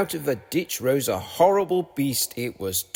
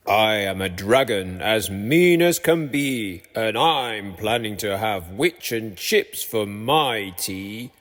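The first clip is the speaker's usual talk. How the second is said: low, slow menacing dragon voice; scary dragon; 5 semitones lower than usual. A narrator's reading. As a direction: growl out the threat like a dragon. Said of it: "a roar that was scary and loud".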